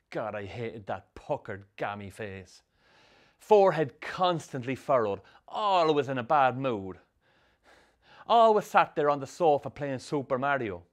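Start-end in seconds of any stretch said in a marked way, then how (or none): none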